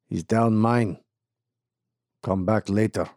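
put-on gruff Eastern European accent, clipped words. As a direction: bark it like a suspicious Eastern European miner. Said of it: "with an Eastern European accent".

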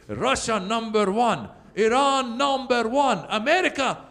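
put-on slow, high-pitched accented wrestling villain boast; Iron Sheik. Wrestler taunting an American crowd, drawn-out villain chant. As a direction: draw out the chant, then spit in contempt. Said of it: "the Iron Sheik would be like".

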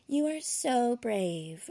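high, drawn-out gushing praise, mimicked; mock admiration. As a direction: imitate an over-sympathetic admirer, very slowly. Mimics gushing admirers she tires of.

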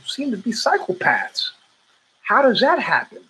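high, puzzled voice of a partygoer at a party; a nosy guest asking, half amused; curious partygoer. Retold or imitated. Partygoer asking Mr Robinson about psychopath friends, high and puzzled.

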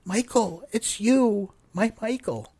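high, loud, overjoyed disbelief; gasping surprise. A narrator's reading. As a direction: cry out on seeing her lost love. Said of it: "She gasped".

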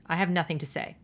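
normal talking voice

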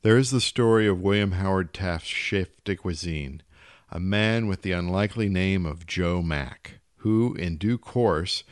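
ordinary delivery, nothing marked

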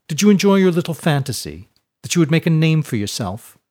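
high, loud taunting question; smug schoolboy rubbing it in; mocking bully. A narrator's reading. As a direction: sneer at a cornered rival's hopes. Smirking bully taunts held boy.

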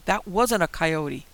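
slow, loud, shaken denial; frightened insistence. Acted. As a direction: press each word out in fear. Insisting after seeing the creature.

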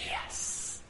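a soft, breathless unvoiced yes; whispered yes. Preacher answering his hater in a whisper. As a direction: hush it like a secret delight.

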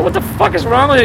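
high-pitched, exasperated disbelief; retold outrage. Young punk challenging his host over the decor, acted-out outrage. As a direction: call him out, appalled.

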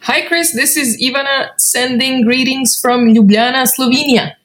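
ordinary delivery

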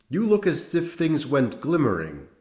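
high, wild exclamation; wild cry. A narrator's reading. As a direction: cry it out wildly. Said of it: "cried Schwartz wildly".

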